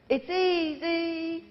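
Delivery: slow, high Liverpool drawl on "easy"; Liverpool accent. Retold or imitated. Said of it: "That's my best Liverpool impression".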